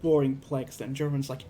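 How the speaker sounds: plain reading voice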